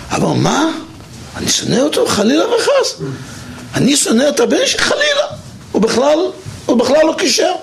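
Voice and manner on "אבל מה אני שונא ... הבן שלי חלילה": shocked disbelief. Retold or imitated. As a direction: voice jumps higher in mock horror at the thought. Father shocked by accusation. (תדהמה מוחצנת בקול גבוה)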